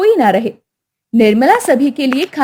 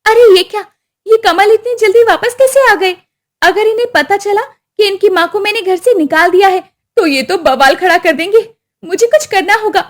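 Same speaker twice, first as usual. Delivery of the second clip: startled wife; 6 semitones higher than usual. Acted. Wife shocked her husband is back, alarmed. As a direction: gasp in panic.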